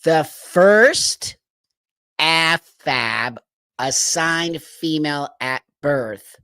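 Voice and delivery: slow, disbelieving recap; incredulous. Real, not acted. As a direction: spell it out slowly.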